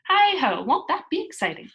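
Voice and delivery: high, loud gleeful whoop; excited cry. Acted. Pippi dreaming of being a princess, gleeful. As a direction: burst out with glee.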